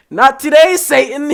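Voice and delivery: high, loud, laughing; playful laughing shout; laughing mock rebuke. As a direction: laugh it off like banishing a demon.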